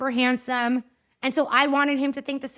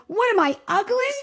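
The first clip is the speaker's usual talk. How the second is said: high, loud mock-offended retort; 8 semitones higher, 5 dB louder than usual. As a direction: play up being insulted, higher. Offended retort to husband's compliment.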